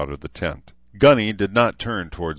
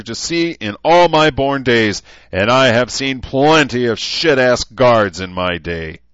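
drill sergeant tirade; 7 semitones higher, 10 dB louder than usual. A narrator's reading. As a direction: hammer each word of the dressing-down. Sergeant chewing out guards.

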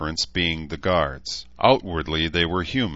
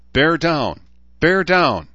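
a bit louder, higher urging; urgent coaching; 10 semitones higher, 7 dB louder, slower than usual. A narrator's reading. Edmund urging his labouring wife, higher and louder. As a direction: press her to push, higher.

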